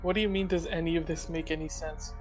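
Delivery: ordinary talk, nothing marked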